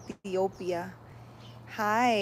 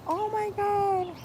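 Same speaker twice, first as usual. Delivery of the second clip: startled oh my god; 11 semitones higher, slower than usual. Real, not acted. Startled by a fly.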